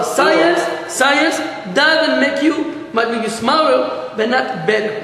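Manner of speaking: slow, pressed science doesn't make you; emphatic moral. Real, not acted. Teacher stressing the lesson to the class, slow. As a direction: spell out the lesson slowly. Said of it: "preacher driving home the moral".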